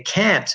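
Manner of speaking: "can't" punched with a full open A; stressed CAN'T. Shown as a demonstration. Teacher contrasting it with can, stressed vowel. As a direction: lean hard on the A in "can't". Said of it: "really stressing the A in can't".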